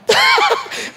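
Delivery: a sudden high burst of laughter. Real, not acted. Preacher laughing at his own joke, a high squealing laugh.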